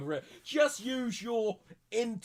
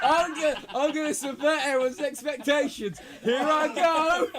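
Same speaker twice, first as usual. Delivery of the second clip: laughing; 7 semitones higher, 4 dB louder than usual. Real, not acted. Joking before his move, laughing.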